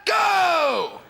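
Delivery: very high, drawn-out shout; yelling. Retold or imitated. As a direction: yell it as a mock-furious order. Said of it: "he yells".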